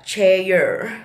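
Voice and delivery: drawn-out Texas "chair" with an added glide. Shown as a demonstration. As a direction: stretch "chair" into two beats. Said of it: "but Texans". ("chair" ala Texas yang dipanjangkan)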